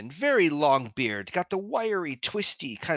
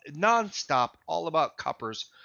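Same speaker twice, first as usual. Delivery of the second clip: normal talking voice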